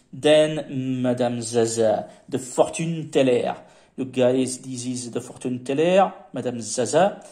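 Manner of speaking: lightly put-on French accent. Presenting the fortune teller figurine, light French accent. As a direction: say it like a Frenchman, Madame Zaza. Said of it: "forget the French accent".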